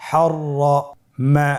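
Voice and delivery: হররমা stretched very slowly with a doubled র; slow drawn-out reading. Shown as a demonstration. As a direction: draw out হররমা sound by sound. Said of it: "আমার সাথে বলুন". (ধীরে টেনে হররমা)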